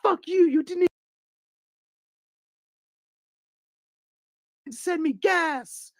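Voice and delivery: high, loud outraged squeal; mock angry yell. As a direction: yell like an offended streamer. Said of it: "she was like".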